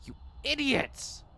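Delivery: high, slow furious yell; furious shout. Acted. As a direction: explode at them. Leader explodes at thieves.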